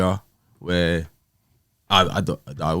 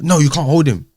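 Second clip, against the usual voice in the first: higher and louder mock refusal; imitating her refusal; 8 semitones higher, 8 dB louder than usual. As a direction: play her flat no, raised. Retold ex refusing.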